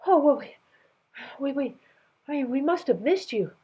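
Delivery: high, halting nervous stammer; flustered servant stuttering excuses. Acted. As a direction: trip over the words in a panic. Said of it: "the voice stuttered".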